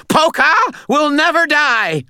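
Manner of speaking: very high, loud scream; frantic, high-pitched rallying scream. A narrator's reading. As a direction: scream it like a battle cry. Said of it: "Butters screamed".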